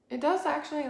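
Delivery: ordinary talk, nothing marked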